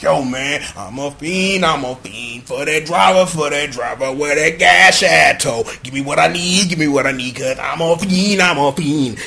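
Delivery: low, repetitive chant of a craving; messed-up fiend. Retold or imitated. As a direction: chant it low like someone stumbling around. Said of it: "he's sitting up here stumbling around".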